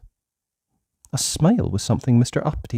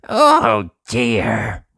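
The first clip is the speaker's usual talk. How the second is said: dismayed gasp; 3 dB louder than usual. Acted. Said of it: "he gasped".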